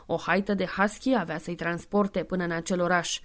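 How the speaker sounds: plain reading voice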